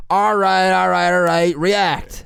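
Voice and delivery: loud, high, brisk acting-coach call; teacher breaking it up. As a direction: cut the scene like a coach. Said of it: "my teacher was like".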